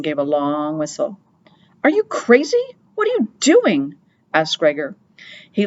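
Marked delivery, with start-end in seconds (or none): 1.8-4.0 high, startled cry of disbelief; shocked, yelping at him; alarmed outburst. Acted. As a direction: Gregor cries out in alarm at Henry. Gregor alarmed at Henry's whistle.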